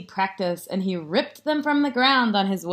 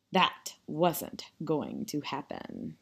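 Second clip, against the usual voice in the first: low, slow, soft narration; hushed aside; 6 semitones lower, 10 dB quieter than usual. A narrator's reading. Narrator confides Jasper won't sleep, hushed. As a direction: lower it right down, word by word. Dry aside after bedtime order.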